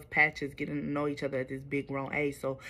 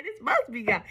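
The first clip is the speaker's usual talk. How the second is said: naive believer; 6 semitones higher than usual. Retold or imitated. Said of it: "What I be saying".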